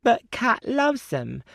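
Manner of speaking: put-on high, slow, mulish child; a little girl pleading; small girl voice. A narrator's reading. Little girl protests to her father, slow mulish child.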